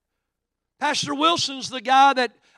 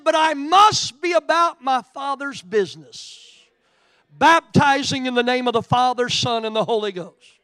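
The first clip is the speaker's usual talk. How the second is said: defiant declaration; 3 semitones higher, 8 dB louder than usual. Retold or imitated. Jailed pastor's defiant vow.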